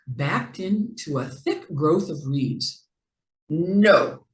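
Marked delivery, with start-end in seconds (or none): 3.5-4.3 firm stubborn refusal. A narrator's reading. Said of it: "he said stubbornly".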